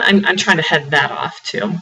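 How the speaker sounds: ordinary delivery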